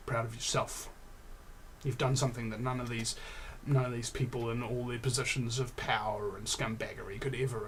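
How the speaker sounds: quiet, gentle encouragement; soft and sincere. Real, not acted. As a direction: speak softly, like a friend. Tender encouragement to listeners.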